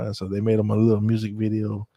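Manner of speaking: normal talking voice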